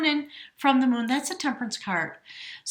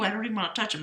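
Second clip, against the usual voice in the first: ordinary talk, nothing marked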